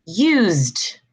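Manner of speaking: "used" as one syllable, yoozd; silent E. Shown as a demonstration. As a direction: end "used" on a voiced D. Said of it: "you're not pronounce the".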